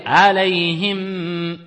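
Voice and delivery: slow recited alayhim with the mim held; tajwid recitation. Shown as a demonstration. Teacher demonstrates alayhim to students, slow recitation. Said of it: "on va dire".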